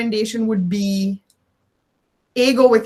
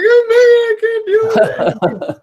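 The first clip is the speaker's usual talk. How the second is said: mock breakdown; 12 semitones higher, 5 dB louder, much slower than usual. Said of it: "And I was like".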